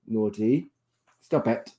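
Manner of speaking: ordinary talk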